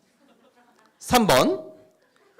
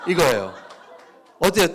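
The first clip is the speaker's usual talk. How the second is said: normal talking voice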